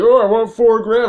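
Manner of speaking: exaggerated high, loud haggling voice; mock seller's demand. Player imagines the junk dealer naming his price, mock loud demand. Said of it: "He's gonna be like".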